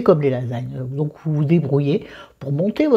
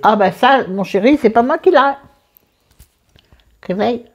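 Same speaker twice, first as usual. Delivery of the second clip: answering someone off-camera; 8 semitones higher, 5 dB louder than usual. Real, not acted.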